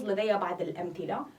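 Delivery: usual speaking voice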